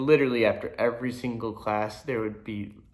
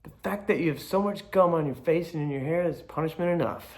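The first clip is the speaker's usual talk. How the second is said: higher-pitched mock telling-off; lightly voiced cross teacher; mad teacher; 6 semitones higher than usual. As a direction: imitate a fed-up teacher giving a lecture. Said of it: "Miss B got so mad".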